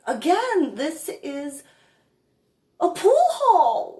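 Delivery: high and loud exasperated retort; high, loud disbelieving retort. Retold or imitated. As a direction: hit him with disbelief on pool hall. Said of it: "And I looked at him like".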